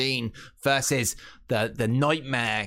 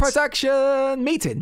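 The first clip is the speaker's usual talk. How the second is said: mock grand announcement; 13 semitones higher, 4 dB louder, slower than usual. Joking about their planning.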